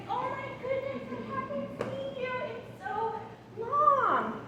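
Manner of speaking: light up like you haven't seen them in ages; excited delight. Shown as a demonstration. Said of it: "when you're excited to see a kid".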